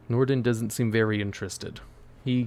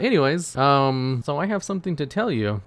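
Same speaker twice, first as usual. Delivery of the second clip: higher, louder brush-past opener; eager newcomer; 4 semitones higher, 4 dB louder than usual. Acted. Newcomer has news for Norden, higher and eager. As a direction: move on briskly in a raised voice. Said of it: "He's like".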